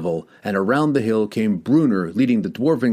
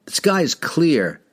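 slow, higher yell; 8 semitones higher than usual. A narrator's reading. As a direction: call it out across the hill. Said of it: "he yelled".

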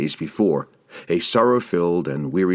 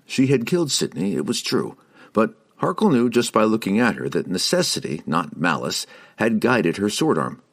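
ordinary delivery

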